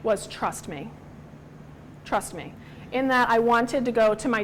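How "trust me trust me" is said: lower, softer reassuring voice; calm a hurting child; God's tender reply, quiet and low; gentle. Retold or imitated. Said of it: "his gentle response to me".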